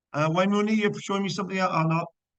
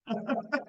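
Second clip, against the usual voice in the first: high embarrassed giggle; 10 semitones higher, 6 dB quieter than usual. Real, not acted. Teacher laughs at herself.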